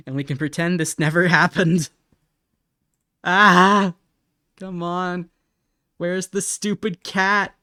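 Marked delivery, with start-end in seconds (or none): 3.2-3.9 sudden loud cry of frustration; frustrated yell. Real, not acted. Streamer yelling while searching for the reference. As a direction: shout in exasperation.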